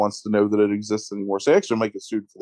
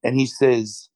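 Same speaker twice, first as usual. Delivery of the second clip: ordinary talk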